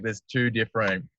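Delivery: normal talking voice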